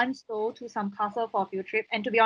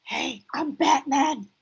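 Batman voice; 3 semitones higher, much slower than usual. Retold or imitated. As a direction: growl it out slowly like Batman. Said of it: "Oh, yeah".

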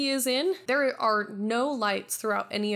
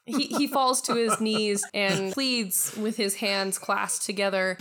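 ordinary delivery

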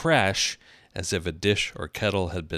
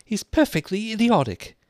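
very high, loud exasperation; high-pitched, desperate girl's voice; 6 dB louder than usual. A narrator's reading. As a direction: burst out with it as a fed-up Alice. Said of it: "said Alice desperately".

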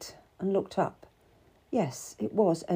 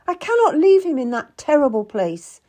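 loud, high, anguished insistence; desperate; 8 semitones higher, 8 dB louder than usual. A narrator's reading. Wife of a Tower prisoner, desperate. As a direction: protest in desperation. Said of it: "I was desperate".

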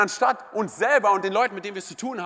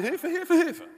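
high-pitched cry of Hilfe; a drowning swimmer crying out high; mock cry for help; 10 semitones higher, slower than usual. Drowning non-swimmer calling out, high panicked cry. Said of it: "Und du wirst schreien".

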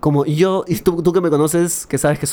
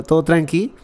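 ordinary delivery

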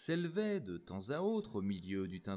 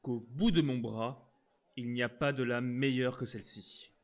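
angry; 2 semitones higher, 5 dB louder than usual. Acted. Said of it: "qui blêmissait de colère".

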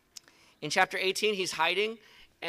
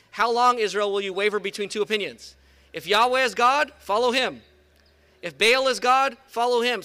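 loud and higher, thundering at the crowd; prophet's loud challenge; 3 semitones higher, 6 dB louder than usual. Acted. Elijah challenging Israel to stop wavering, loud and high. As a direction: call the people out with full force.

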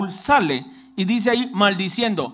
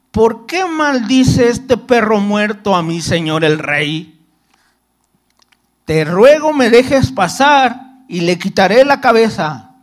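scripture read aloud, a little louder; lectura bíblica; 5 dB louder than usual. Acted. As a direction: read the verse out to the congregation. (leyendo la Biblia en voz alta)

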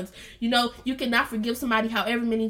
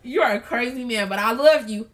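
loud, amused exclamation; retelling her loud, excited aside to God; excited outburst to God; 8 dB louder than usual. Retold or imitated. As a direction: blurt it out in delighted disbelief. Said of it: "I'm like".

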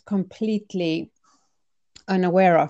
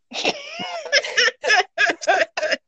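high, rippling burst of laughter; real high-pitched laugh at an absurd question; long peal of high laughter; amused laugh. Real, not acted.

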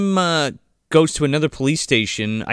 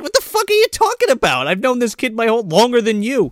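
mock indignant retort; 11 semitones higher, 4 dB louder than usual. Acts out Susan's angry retort.

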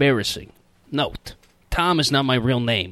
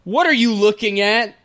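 mom voice; 8 semitones higher than usual. Acted. Reading the mom line in script.

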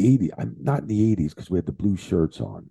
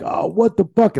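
high-pitched exclamation of dismay; retold exasperation; 12 semitones higher, 4 dB louder than usual. Referee realising the music played too soon, exasperated. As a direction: relive the exasperated outburst. Retells his own dismay at the mistake.

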